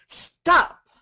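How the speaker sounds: sudden high, loud "Stop!"; commanding shout. Shown as a demonstration. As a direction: shout it like halting a bad thought. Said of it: "and say".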